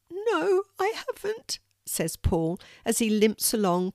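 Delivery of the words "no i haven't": put-on higher child voice; lightly voiced young boy; small boy. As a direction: play a little boy, a bit shy. Boy answers.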